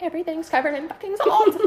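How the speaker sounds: high-pitched laughing complaint; high, amused retold protest; exasperated. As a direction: wail it high in mock dismay. Retold exasperation at salt mess.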